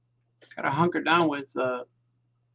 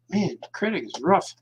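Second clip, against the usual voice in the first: normal talking voice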